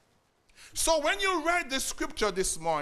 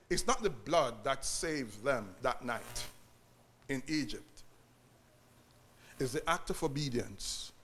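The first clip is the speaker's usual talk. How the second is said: much lower, softer preaching voice; hushed restatement; 10 semitones lower, 7 dB quieter than usual. Real, not acted. Preacher restating the point to church, low and hushed. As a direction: drop low and quiet to let the point sink in. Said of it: "let me say it this other way".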